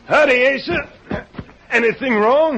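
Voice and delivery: high, loud hail across a distance; shouted call to someone approaching. Acted. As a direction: holler a greeting and question. Calling to approaching man.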